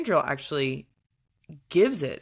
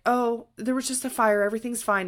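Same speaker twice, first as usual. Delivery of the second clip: mock breezy denial; 8 semitones higher than usual. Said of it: "is like".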